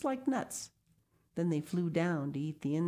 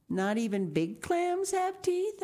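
put-on high, slow wondering child's voice; astonished little girl; 14 semitones higher, 3 dB louder than usual. A narrator's reading. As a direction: ask in disbelief like a small girl. Sal asks father.

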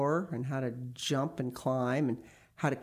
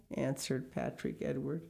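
usual speaking voice

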